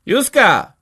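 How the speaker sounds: high, loud shout after someone; sharp high yell of a name; calling out. A narrator's reading. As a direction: call after her as she leaves. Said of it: "krzyknęła jeszcze za nią".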